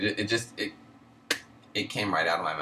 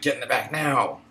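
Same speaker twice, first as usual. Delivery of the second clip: higher, louder mock-furious command; angry order; 7 semitones higher, 6 dB louder than usual. Manager throwing her employee to the back of the store, angry order. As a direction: imitate a boss ordering someone out, raised and forceful.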